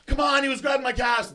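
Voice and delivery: high, loud retold protest. Arguing with the police arresting him, loudly protesting. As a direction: plead your case at a yell.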